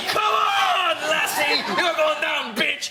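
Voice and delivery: high, shouted mock threat; fighting stag. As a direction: yell it like a stag charging the hounds. Stag acted charging at hounds.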